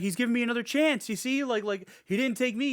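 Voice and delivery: high, eager imitation; mock relieved husband bargaining for his life; put-on wheedling voice; acted desperate pleading.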